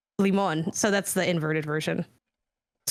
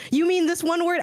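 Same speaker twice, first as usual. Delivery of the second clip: mock exasperated listener; 9 semitones higher than usual. Imagined podcast listener yelling a correction, mock exasperated. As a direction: toss it back like an annoyed listener correcting you. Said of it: "someone's yelling at the".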